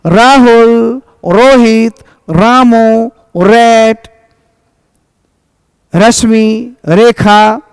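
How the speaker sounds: each name with a strong र at the start; stressed initial r. Shown as a demonstration. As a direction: punch the first र. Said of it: "शम में आर को जोर देना है".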